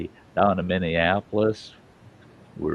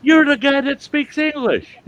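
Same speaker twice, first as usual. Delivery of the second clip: much higher and louder, delighted; excited recognition; 6 dB louder than usual. Retold or imitated. Teller greeting the man he met in Montana, excited. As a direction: point at him like you've cracked a mystery.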